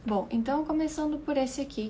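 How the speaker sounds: ordinary talk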